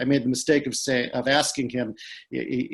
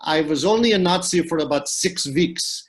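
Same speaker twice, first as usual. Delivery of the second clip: put-on a light German accent, pitched higher, as an old man telling his war story; imitating Freddie's accent; 4 semitones higher, 4 dB louder than usual. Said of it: "I'll try and do my Freddie accent".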